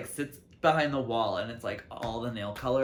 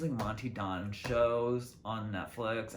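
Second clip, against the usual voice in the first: normal talking voice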